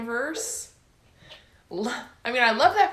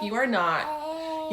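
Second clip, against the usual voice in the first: slow and higher, leaning on are; emphatic denial; 6 semitones higher, much slower than usual. Real, not acted. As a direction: drag out You are not with weight on are. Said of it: "warning readers about Danica".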